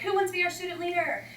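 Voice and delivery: high, bright call to the class; cheery teacher. Retold or imitated. Coach asks the class for a student leader, high and eager. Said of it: "So I'm like".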